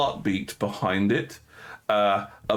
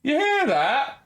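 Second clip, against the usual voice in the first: a lightly raised guard voice; 13 semitones higher, slower than usual. Acted. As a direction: alert guard asking, pitched up. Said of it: "you do hear a voice go".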